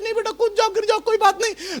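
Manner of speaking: mock-casual parent, pitched well up; unconcerned parent. Imagined parent telling child on the roof to jump, mock-unconcerned. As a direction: wave it off in a high, put-on voice. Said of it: "आराम से बैठे होते और देख रहे होते".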